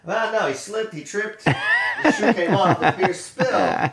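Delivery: laughing through the words; cracking up mid-sentence. Real, not acted. Mock retelling while laughing.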